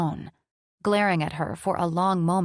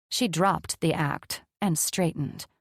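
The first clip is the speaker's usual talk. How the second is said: plain reading voice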